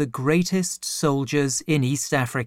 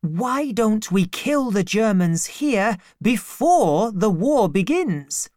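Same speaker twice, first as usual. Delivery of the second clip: put-on higher eager boy's voice; eager servant boy; 7 semitones higher than usual. A narrator's reading. Servant boy urges striking before the war, eager. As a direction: press the point, itching to act.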